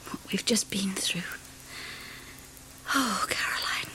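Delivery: soft, emotional hushed thanks; tired whisper. Acted. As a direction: whisper gratitude beside the baby. New mother whispers to friend.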